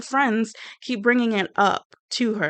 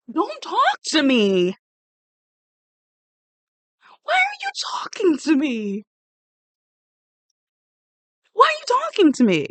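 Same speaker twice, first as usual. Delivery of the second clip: high, mock-outraged yell; indignant outburst; 7 semitones higher than usual. Snapping at the ex who wants a one-on-one, mock-outraged. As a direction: draw it out in exaggerated high-pitched indignation. Acted reaction to the ex-girlfriend.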